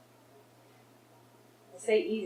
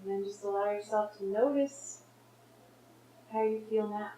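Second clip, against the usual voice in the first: guided meditation. Real, not acted. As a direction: gently guide them to notice, leaving space.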